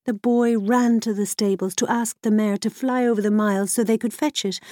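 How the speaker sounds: ordinary delivery, nothing marked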